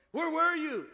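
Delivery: put-on loud, high shout at the lost boy; angry parent shout. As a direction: snap it out like a scared, angry dad. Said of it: "I got mad at Johnny for getting lost".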